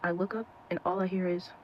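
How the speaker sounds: ordinary delivery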